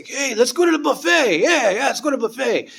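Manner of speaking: high, eager, sing-song invitation; Pepper's buffet invite. Retold or imitated. Teacher inviting student to the buffet, high and eager. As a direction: coax a friend to the buffet, pitched well up. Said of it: "he would say".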